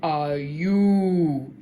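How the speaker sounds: huge booming giant voice; thundering giant. A narrator's reading. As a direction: boom it out like a giant. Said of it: "thundered the giant".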